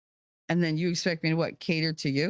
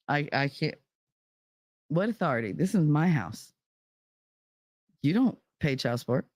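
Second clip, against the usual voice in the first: normal talking voice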